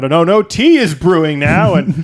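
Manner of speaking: high, loud frantic wail; mock panic. Doomed man panicking at the Rube Goldberg machine, shrieking. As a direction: shriek in rising panic about the dominoes.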